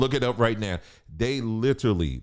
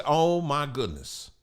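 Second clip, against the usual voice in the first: usual speaking voice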